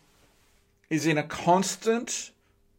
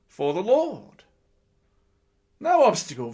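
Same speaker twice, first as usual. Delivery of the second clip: ordinary delivery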